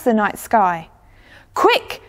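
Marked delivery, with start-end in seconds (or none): 1.5-2.0 high, excited call; calling to a friend. A narrator's reading. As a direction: call your friend over, pitched way up. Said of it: "called one of the star watchers to his friend".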